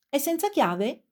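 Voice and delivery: mildly projected question, pitch lifted; calling out. A narrator's reading. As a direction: ask it as if calling down to the street, without a full shout. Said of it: "gridando per farsi sentire".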